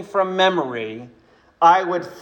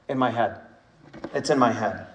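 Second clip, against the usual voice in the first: quiet reply; 6 semitones lower, 8 dB quieter, faster than usual. Retold or imitated. Mozart's quiet reply voiced.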